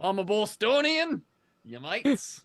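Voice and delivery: playing along with a fake Australian-tinged Boston accent; mock Boston accent. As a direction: join the bit with a hammed-up Aussie accent. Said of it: "can't you tell that I'm a".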